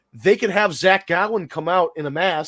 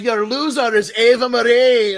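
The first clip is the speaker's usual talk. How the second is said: loud, high mock-Scottish announcement; hammed-up Scottish brogue, pitched way up; Drew; 8 semitones higher, 7 dB louder than usual. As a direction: bellow it in a faked Scottish accent. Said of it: "that was like my Drew".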